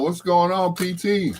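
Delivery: ordinary talk, nothing marked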